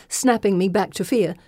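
ordinary delivery

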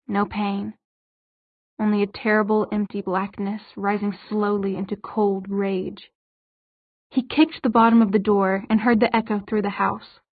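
plain reading voice

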